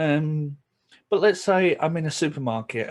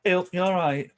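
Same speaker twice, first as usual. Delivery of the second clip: Yorkshire greeting; 6 semitones higher, 4 dB louder than usual. Shown as a demonstration.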